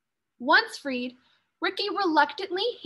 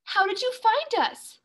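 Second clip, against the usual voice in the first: amazed question; 8 semitones higher than usual. Acted. Children amazed at rescue.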